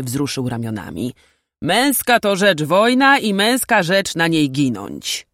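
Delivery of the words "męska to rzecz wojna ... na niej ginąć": firm, raised, louder declaration; emphatic older warrior's pronouncement. A narrator's reading. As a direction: state it forcefully as a hard truth. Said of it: "powiedział dobitnie".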